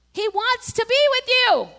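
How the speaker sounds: high, loud, slow proclamation; impassioned preaching. Real, not acted. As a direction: drive it home to the congregation. Preaching at full force.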